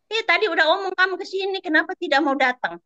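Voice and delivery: exasperated quoted complaint in a high voice; imitated scolding. Retells employer scolding her for not coming.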